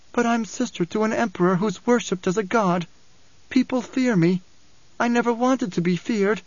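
high, plaintive character voice; troubled young Alia. A narrator's reading. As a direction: confess sorrow in a high voice. Alia's voice in narration.

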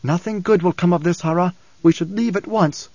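usual speaking voice

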